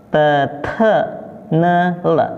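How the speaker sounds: "Te, te" unpuffed then puffed, then "ne", "le"; separate, even syllables "Te, te, ne, le"; pinyin sound drill. Shown as a demonstration. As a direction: model "Te, te, ne, le" as schwa syllables. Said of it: "di situ ada cara bacanya".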